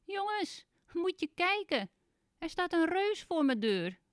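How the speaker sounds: high, loud call through cupped hands; little man calling his friends to come and look. Acted. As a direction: shout it to the others in the trees. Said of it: "roept heel hard".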